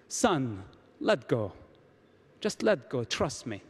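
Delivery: a hint of a low heavenly voice; voice from the cloud. God calls down to the hanging man, lightly voiced as a low voice from the cloud. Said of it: "he heard a voice from the cloud coming".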